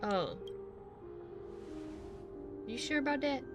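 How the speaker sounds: normal talking voice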